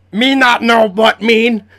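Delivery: loud, high, slow monster voice; halting creature struggling with the language, loudly; broken-language monster. Acted. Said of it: "says in broken common".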